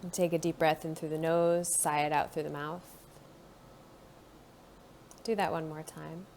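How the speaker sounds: calm guided body-scan voice; guided meditation. Acted. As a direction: ease the listener inward, gently.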